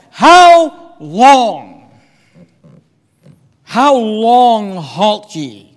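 loud, drawn-out exasperated demand; frustrated prophet calling out. Acted. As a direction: stretch each word out slowly in exasperation. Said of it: "see if you can hear the frustration".

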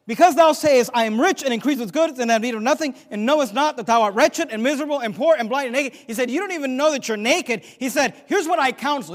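loud, high-pitched scripture proclamation; forceful preaching. Acted. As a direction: hammer the verse out to the congregation.

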